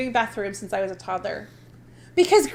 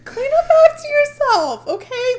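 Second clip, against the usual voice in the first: scolding; 12 semitones higher, 6 dB louder than usual. Real, not acted. Scolding strangers who pee on seats.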